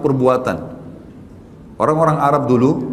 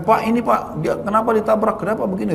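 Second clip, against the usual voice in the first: retold fussing accusation; 7 semitones higher than usual.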